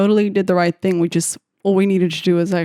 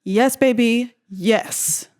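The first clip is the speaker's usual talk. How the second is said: ordinary talk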